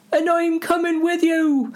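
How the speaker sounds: very high falsetto; insistent Sam. Acted. Sam insists on joining Frodo, squeaky falsetto.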